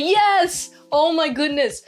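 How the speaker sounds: high, elated fan reaction; excited cheer. Retold or imitated. Cheering on the character's stand.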